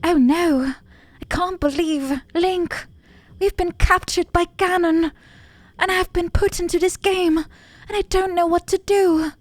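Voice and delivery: high, distressed princess plea; damsel princess voice. Acted. Gamer voicing captured Zelda pleading to Link, high and dramatic. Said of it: "is she British nowadays".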